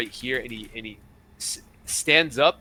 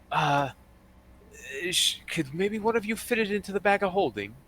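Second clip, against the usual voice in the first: higher-pitched, tentative request; hesitant woman character; 6 semitones higher than usual. Acted. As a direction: lift the voice high for a hesitant woman. Game master voices a female character.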